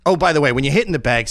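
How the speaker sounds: ordinary talk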